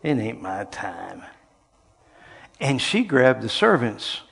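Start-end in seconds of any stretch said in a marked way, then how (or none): 0.0-1.2 lightly voiced son, lower and slow, rolling his eyes; a mild weary brush-off to his mother; eye-rolling reply. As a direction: son to mother, a hint of exasperation. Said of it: "he rolled his eyes and said".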